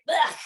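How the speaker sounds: high disgusted grunt of revulsion; disgusted ugh. Retold or imitated. Throwing the Bible away, high disgusted ugh.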